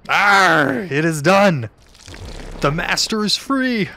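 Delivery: loud gloating villain cry; triumphant villain. Acted. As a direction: boom it out like a freed sorcerer. Voicing game villain's triumphant release.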